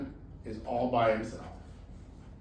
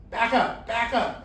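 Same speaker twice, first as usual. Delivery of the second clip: higher, louder warning; man warns the tiger below, louder call; 9 semitones higher, 6 dB louder than usual. Acted. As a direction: shoo him back from the edge.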